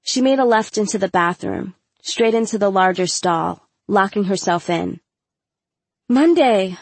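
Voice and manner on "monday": out of breath calling a name; wheezing. A narrator's reading. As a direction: wheeze it after the chase. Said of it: "I wheezed".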